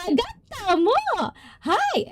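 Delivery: very high, squealed Japanese exclamation; excited exclamation. Real, not acted. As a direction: pitch it way up, playfully excited.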